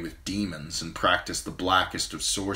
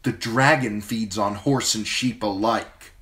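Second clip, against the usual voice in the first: higher, louder queenly retort; angry; 4 semitones higher, 5 dB louder than usual. Acted. Queen turns angrily on the rider, higher louder retort. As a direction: snap back at him with heat. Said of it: "Danny turned on him angrily".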